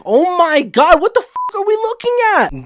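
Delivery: mock shocked viewer; stunned disbelief. Imagined reaction to UFO sighting.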